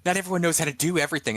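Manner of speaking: high, quick defensive retort; mock argument yell. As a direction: imitate a flustered driver arguing back. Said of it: "they start yelling back and forth".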